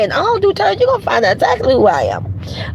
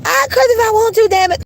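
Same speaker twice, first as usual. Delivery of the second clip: mock kid scream; 9 semitones higher than usual. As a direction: scream it up the stairs like a kid daring her brothers. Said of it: "so I screamed".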